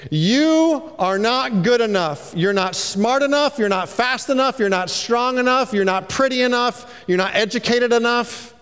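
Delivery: put-on King Saul belittling young David; dismissive king. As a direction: cut him down line by line. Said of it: "And Saul said to David".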